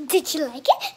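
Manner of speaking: ordinary delivery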